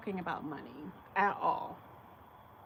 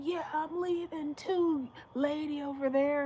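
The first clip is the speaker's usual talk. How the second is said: imitating the man; 8 semitones higher, 4 dB louder than usual. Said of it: "And he was like".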